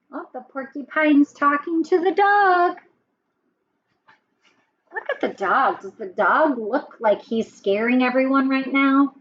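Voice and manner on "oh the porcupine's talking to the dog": usual speaking voice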